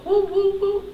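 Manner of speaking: high, hooted "woo" repeated; playful woo-woo. Retold or imitated. Coach showing how to get in there, playful high woos. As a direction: hoot it lightly, way up high. Imitates diving in after trauma.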